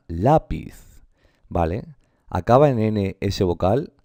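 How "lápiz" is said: "lápiz" with a firm LÁ, falling on piz; stress on penultimate syllable. Shown as a demonstration. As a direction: punch LÁ in "lápiz". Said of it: "Y es llana". ("lápiz" con la fuerza en la primera sílaba: LÁ-piz)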